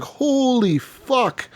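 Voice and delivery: drawn-out high holy fuck, slow; stunned disbelief. Retold or imitated. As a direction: let the shock stretch out slowly. Sudden attack on camp.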